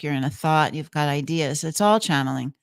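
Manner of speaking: ordinary talk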